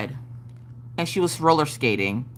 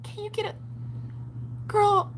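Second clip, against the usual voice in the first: imitating daughter; 10 semitones higher than usual. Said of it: "she's like".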